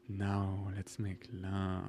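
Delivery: slow, hushed seductive voice; sultry whisper. Pretend. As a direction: breathe it low and slow. Joking seductive line.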